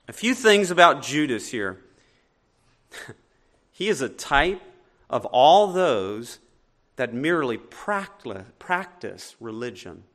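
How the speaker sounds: ordinary delivery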